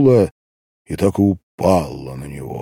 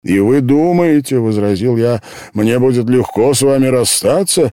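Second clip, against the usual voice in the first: raised, louder voice, protesting; heated objection; 5 semitones higher, 6 dB louder than usual. A narrator's reading. Man objecting to the girl's farewell, heated. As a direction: object hotly, higher and louder.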